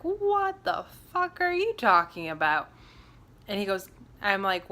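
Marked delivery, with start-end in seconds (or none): 0.0-2.7 much higher, incredulous outburst; shocked disbelief. Retold or imitated. She reacts to the medium's warning, shocked and high. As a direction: throw it back in stunned disbelief.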